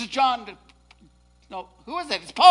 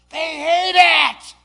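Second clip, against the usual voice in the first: loud and slow, hammering each word; slow preacher's shout; shouted emphasis; 8 semitones higher, 16 dB louder, much slower than usual. Real, not acted. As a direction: bang it out with force.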